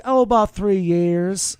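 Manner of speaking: put-on Southern accent; Southern belle accent. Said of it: "she had that accent".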